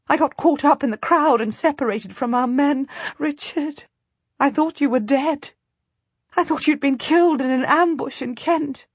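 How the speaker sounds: high, tearful rush of relief. A narrator's reading. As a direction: pour out the fear now it's over. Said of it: "tears running down my face, laughing".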